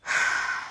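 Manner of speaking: long, breathed-out sigh; retold sigh of realisation; weary sigh. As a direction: let out a slow exasperated breath. Acts out her reaction.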